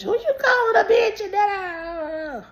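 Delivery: high strained shout, slowing; hammed-up tearful scream; mock crying yell. Girl yelling back on the escalator, shouting.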